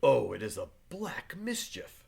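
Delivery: hushed, breathy whisper of dread; fearful whisper. Acted. Wife confiding her fear of the court, whispered. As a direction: whisper it as if the walls could hear.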